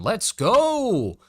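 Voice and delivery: high, drawn-out hype shout; excited cheer. Real, not acted. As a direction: celebrate a win with a high cheer. Cheering for finishing the challenge.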